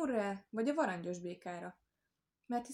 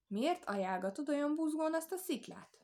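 high-pitched, probing question; the wolf smelling a trick; suspicious; 7 semitones higher than usual. A narrator's reading. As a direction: question it warily, voice lifted. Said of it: "kérdezte gyanakodva a farkas".

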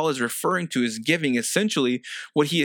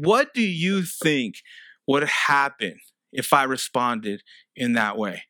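ordinary talk, nothing marked

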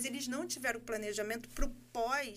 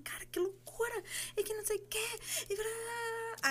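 euphoric; 8 semitones higher than usual. Retold or imitated. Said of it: "naquela euforia".

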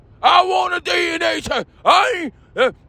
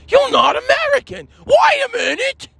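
much higher, indignant mock protest; mock indignant outburst; 9 semitones higher than usual. Brit rebuffed over tea, high indignant outburst. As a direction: jump up high in acted outrage.

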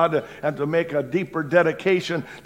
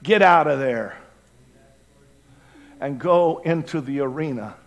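ordinary talk

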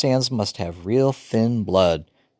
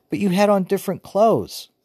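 getting higher; 11 semitones higher than usual. A narrator's reading. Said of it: "Joey's voice was getting higher".

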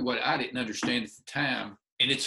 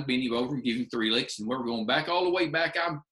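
usual speaking voice

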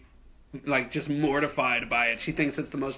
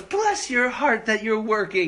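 slow, higher imitation of a sympathetic woman; gushing lady customer; 7 semitones higher, slower than usual. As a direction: coo it slowly like a pitying customer. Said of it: "she goes".

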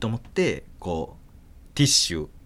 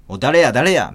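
stern mother; 3 semitones higher, 7 dB louder than usual. Retold or imitated. Mother interrogating the kids.

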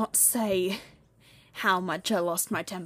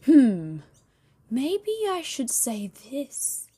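drawn-out, higher musing; acted pondering; 5 semitones higher, 4 dB louder, much slower than usual. Speaker wondering at night about podcast topics, acted pondering. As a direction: mull it over slowly to yourself. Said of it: "I was just like".

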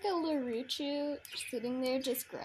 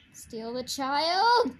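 mock villain cry; 9 dB louder, slower than usual.

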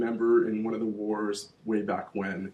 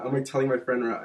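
ordinary talk, nothing marked

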